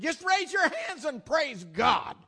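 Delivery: slow, high, loud call to praise; preacher shout. Retold or imitated. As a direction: shout it out to the whole crowd. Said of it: "I call him Wild Faith".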